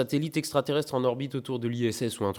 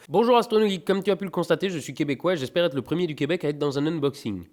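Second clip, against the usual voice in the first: letter read aloud; 4 semitones higher, faster than usual. Acted. Reading Québec viewer's letter.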